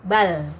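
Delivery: bal with the l held still; bal, the l not bounced; sukun lam demo. Shown as a demonstration. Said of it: "Ketika sukun".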